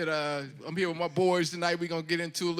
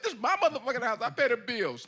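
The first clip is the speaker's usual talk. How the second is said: higher-pitched, laughing protest; mock indignant homeowner; 6 semitones higher, 3 dB louder than usual. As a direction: assert ownership in a playful mock huff.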